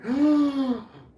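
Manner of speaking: big, loud, high gasp; mock shocked gasp. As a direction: gasp as if amazed by the puppy's first bark. Retold shock at first bark.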